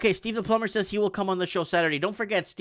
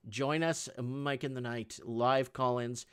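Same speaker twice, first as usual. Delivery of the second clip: ordinary talk, nothing marked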